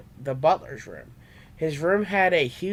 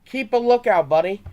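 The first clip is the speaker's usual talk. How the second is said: higher, louder warning call; yelled; 5 semitones higher, 5 dB louder than usual. A narrator's reading. Trey warning Charmander mid-battle, loud yell. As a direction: shout the warning across the field. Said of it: "Trey yelled".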